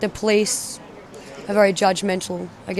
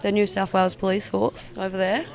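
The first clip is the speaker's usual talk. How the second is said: ordinary talk, nothing marked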